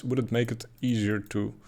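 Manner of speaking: usual speaking voice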